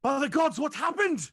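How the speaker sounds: loud, high, shocked guard voice; hammed-up guard's exclamation; alarmed guard. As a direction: cry out in horror at the scene. Game master voices alarmed guard.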